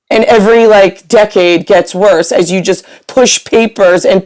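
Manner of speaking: loud, emphatic scolding; fed-up tirade at useless paper pushers; exasperated rant. Real, not acted. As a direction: hammer out each accusation forcefully. Rant at those who failed speaker.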